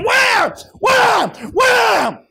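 loud, high, hammered cries of the lash; each blow shouted out; shouted whip cracks. Retold or imitated. Preacher acts out Jesus whipping, shouting each blow.

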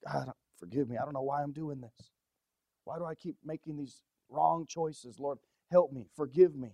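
soft, low, broken-hearted plea to God; a murmured prayer for forgiveness; hushed repentant prayer. Acted. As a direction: pray quietly, ashamed and begging. Dramatized prayer of a repentant sinner.

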